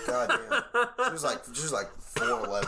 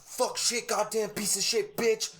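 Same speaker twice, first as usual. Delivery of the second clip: mock cursing; 8 semitones higher, slower than usual. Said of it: "you're like".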